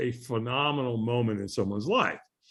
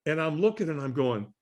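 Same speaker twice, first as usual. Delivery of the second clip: ordinary delivery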